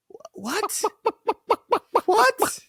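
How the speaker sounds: high, laughing stunned what; disbelieving squeak. Retold or imitated. Reacting to fans calling him scared, high incredulous laughing what.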